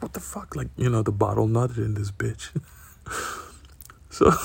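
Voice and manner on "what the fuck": much higher and softer, gasped; a startled little shriek; startled cry. Retold or imitated. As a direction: she reacts as the beer explodes inside her. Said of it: "she's like".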